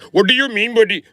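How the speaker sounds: put-on very high, loud Indian accent; mock Indian accent. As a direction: squeal it in a hammed-up Indian accent. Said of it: "you got a fucking Indian accent".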